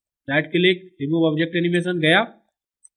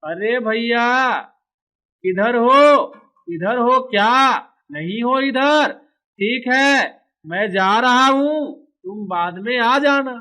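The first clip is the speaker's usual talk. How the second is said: high, drawn-out calling voice; animated character calling; 4 semitones higher, slower than usual. Acted. Character calling for brother then leaving, drawn-out calling. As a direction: call out for someone, stretching the endings. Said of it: "अब ये बोलेगा".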